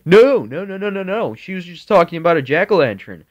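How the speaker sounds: lightly voiced dad brushing it off; daddy protesting. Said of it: "my daddy's like".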